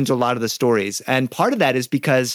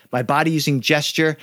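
ordinary talk

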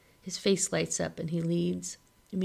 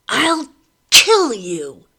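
high, loud, slow scream of rage; furious shriek; 5 semitones higher, 15 dB louder, much slower than usual. A narrator's reading. Mother raging at the boy who took her baby, shrieking. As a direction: shriek it at him like you mean murder. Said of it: "Anne shrieks".

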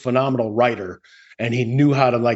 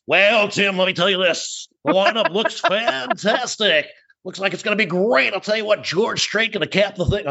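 high, puking eighties announcer; hammed-up radio voice with stretched-out words; Al Farb; 6 semitones higher than usual. Host mimics producer's first time on air, hammed-up announcer voice. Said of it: "What's that voice?".

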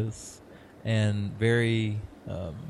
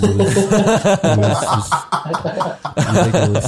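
laughing; 6 semitones higher, 6 dB louder than usual. Real, not acted. Laughing through his review score.

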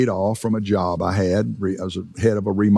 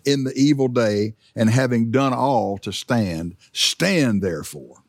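slow, leaning on "stand" each time; slow scripture reading stressing "stand"; emphasis on stand. Acted. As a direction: let "stand" land heavy and slow. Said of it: "notice the emphasis on stand".

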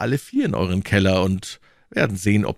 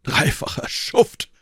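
higher, drawn-out cry of contempt; shouted insult; 4 semitones higher, slower than usual. A narrator's reading. Athos insulting the innkeeper, a restrained shout. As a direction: hurl the insult slowly, mildly raised. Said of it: "schrie Athos und stand auf".

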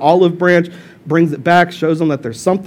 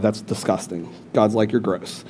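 disgusted God; 8 semitones lower, 13 dB quieter than usual. Acted. God scolds eating live meat.

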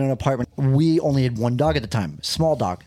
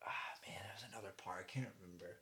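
whispered under his breath; whispering; 6 semitones lower, 29 dB quieter, much faster than usual. Real, not acted. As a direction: whisper it to yourself, searching memory. Trying to remember, unvoiced.

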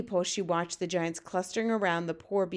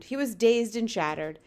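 plain reading voice

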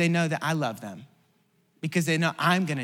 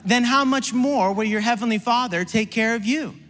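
higher, louder preaching over music; 6 semitones higher, 4 dB louder than usual. Acted. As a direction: lift the room with each line.

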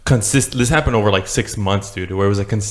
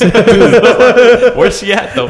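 much higher and louder, through laughter; helpless loud laugh in the words; real laughing; 12 semitones higher, 9 dB louder, much slower than usual. Real, not acted. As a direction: crack up while asking. Cracking up at friend's joke.

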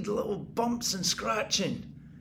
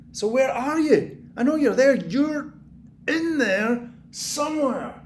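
raised, coaxing call to the walls; taunting call; 5 semitones higher, 5 dB louder than usual. Acted. Man calls into the walls at hidden rats, raised taunt. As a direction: call out to something hiding.

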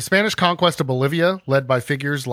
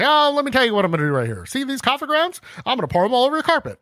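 higher, fast hammed-up door-to-door pitch; pushy salesman; 9 semitones higher, faster than usual. Host plays a conquistador as a door-to-door salesman, pushy and fast. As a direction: rattle off the sales patter. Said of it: "like, a door-to-door salesman".

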